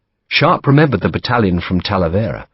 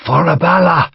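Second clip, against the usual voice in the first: Irish battle cry; 9 semitones higher, 4 dB louder than usual. Acted.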